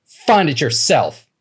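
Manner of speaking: high, loud, slow spat defiance. Acted. Woman spitting defiance at the robber, slow and loud. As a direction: spit it back at him. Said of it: "she spat".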